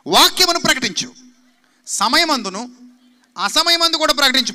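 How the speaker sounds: fiery pulpit proclamation of scripture; preaching at full force. Acted. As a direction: thunder Paul's command at the church.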